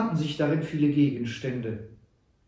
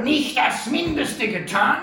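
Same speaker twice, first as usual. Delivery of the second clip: mocking echo; 12 semitones higher, 7 dB louder, slower than usual. A narrator's reading. Sam mocks Butler's words.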